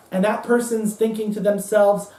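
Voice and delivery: normal talking voice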